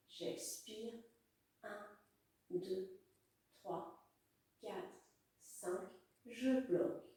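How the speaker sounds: soft, fading breath count; guided breathing count. Acted. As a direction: count softly, letting it fade.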